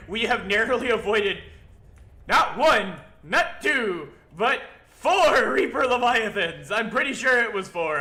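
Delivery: loud, high-pitched triumphant count; excited announcing. Real, not acted. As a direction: announce your narrow escapes, loud and emphatic. Proudly tallying monsters he avoided.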